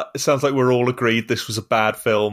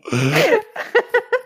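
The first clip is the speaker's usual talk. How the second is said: high giggle. Real, not acted. Laughing after accent attempt.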